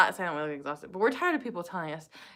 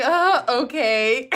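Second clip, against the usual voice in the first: slow, louder hesitant reply; awkward uh okay; 4 semitones higher, 7 dB louder, much slower than usual. Retold or imitated. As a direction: play it taken aback and polite. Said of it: "You're just like".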